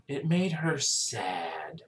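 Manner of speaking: hushed, drawn-out echo of the phrase; quiet, slow repeat. Real, not acted. Repeating the doctor's words, moved.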